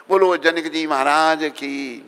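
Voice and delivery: slow, drawn-out devotional call; long ringing call for response; rallying acclamation. Real, not acted. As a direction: lead the crowd, stretching the last words. Leads crowd in acclamation.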